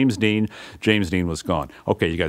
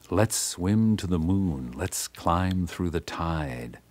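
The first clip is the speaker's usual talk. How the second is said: slow, drawn-out recital of the lines; reciting the lyric; slower than usual. Retold or imitated. Recalling Jim's lyric on the beach, slow and spoken. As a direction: lay out each line slowly. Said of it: "And he starts to sing".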